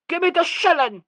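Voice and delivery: put-on high, loud demanding child; yelling child. A narrator's reading. Sissy grabbing for the coin, yelling. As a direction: yell it like a greedy girl. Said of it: "yelled Sissy".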